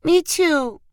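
put-on high little-girl voice; little girl chiming in. A narrator's reading. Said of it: "Sadie echoed".

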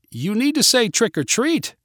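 high-pitched parental prompt; urging. A narrator's reading. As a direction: coax the kids to speak up. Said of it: "urged the clown parent".

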